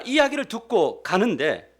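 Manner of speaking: ordinary talk, nothing marked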